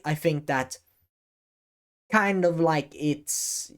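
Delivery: slowed, deliberate take with a pause. Shown as a demonstration. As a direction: take your time between words. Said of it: "I can say".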